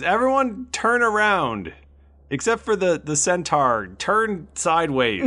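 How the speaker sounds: acted guard's command; guard giving orders. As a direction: call out orders to a group of prisoners. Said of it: "and he goes".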